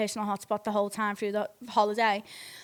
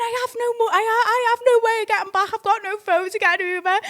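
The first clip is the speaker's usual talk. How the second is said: very high, stammering imitation of boyfriend; mock frantic excuse; 11 semitones higher, 5 dB louder than usual. Boyfriend defends himself to angry girlfriend, mock frantic. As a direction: protest in a loud high put-on voice.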